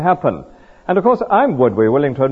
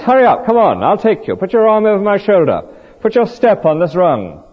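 higher-pitched, urgent coaxing; urgent rescuing fireman; 6 semitones higher than usual. Acted. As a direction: urge them onto the ladder as their rescuer. Fireman urging trapped person out.

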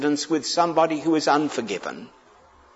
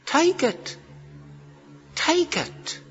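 urging; 13 semitones higher, 6 dB quieter, slower than usual. Retold or imitated. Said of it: "he says".

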